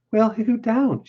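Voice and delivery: high, light woman's voice; Southern-drawling young wife. Acted. Ranch wife reassures simple man, high feminine voice. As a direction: play the wife, pitched well up.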